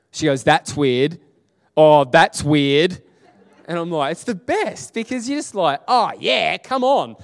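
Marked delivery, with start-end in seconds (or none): 0.4-3.0 louder, slower amazed repeat. Retold or imitated. Cashier realizing her back pain is gone, louder in amazement. 5.9-7.1 higher, louder retold cheer; excited cheer. Pastor cheering as the cashier's pain leaves, higher and louder.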